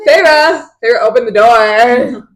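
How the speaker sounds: hammed-up plea to be let in; pleading at the door. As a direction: plead loudly through a door. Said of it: "he's like".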